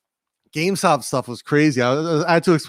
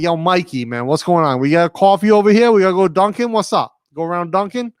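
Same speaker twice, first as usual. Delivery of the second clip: loud, higher mock Boston banter; New England accent; 4 semitones higher, 4 dB louder than usual. Streamer greeting Mikey about a Dunkin' run, New England accent. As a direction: holler like a New England buddy, accent laid on thick. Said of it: "we'll jump into our, uh, New England accents".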